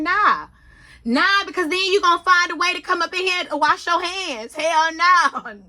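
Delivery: loud, high, emphatic no; firm refusal. Retold or imitated. She refuses to let him come along, loud and emphatic. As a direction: shut him down hard. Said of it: "I'm like".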